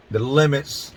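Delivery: normal talking voice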